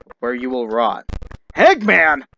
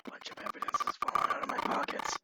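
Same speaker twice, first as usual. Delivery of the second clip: whispered aside; 12 dB quieter, faster than usual. Real, not acted. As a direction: whisper it, hushed. Quiet aside narration.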